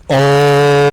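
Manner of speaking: sudden loud yell into the mic; mic-blasting yell. Retold or imitated. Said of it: "turning the gain up on their mic and then going".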